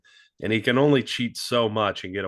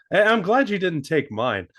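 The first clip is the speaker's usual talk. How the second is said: ordinary talk, nothing marked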